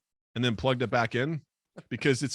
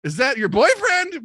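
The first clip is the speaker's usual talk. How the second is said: much higher, louder flustered questions; panicked confusion; 10 semitones higher, 4 dB louder, slower than usual. Retold or imitated. Narrator confused by the woman and Colin, high and frantic. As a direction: fire the questions in a rising panic. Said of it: "I'm like".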